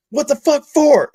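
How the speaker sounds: exasperated. Retold or imitated. Coworker's exasperated retold question.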